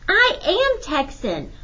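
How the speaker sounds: put-on high, slow, indignant child's protest; angry cry. A narrator's reading. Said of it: "Lupe cried angrily".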